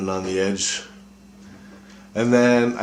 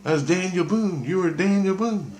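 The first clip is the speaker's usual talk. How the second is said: much higher revelation voice, imitated; Christ's voice; 11 semitones higher than usual. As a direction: announce the past life from way up high. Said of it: "he goes".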